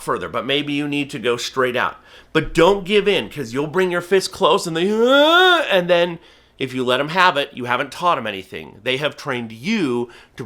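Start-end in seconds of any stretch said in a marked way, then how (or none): 4.9-5.6 high, loud straining uh; bird's eager grunt. Retold or imitated. A falconer mimicking a hawk reaching for the fist, high straining uh. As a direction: strain forward like a bird lunging for meat.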